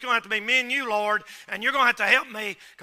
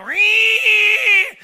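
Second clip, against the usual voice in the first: mock tire squeal; 9 semitones higher, 9 dB louder than usual. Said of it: "then take off and then go".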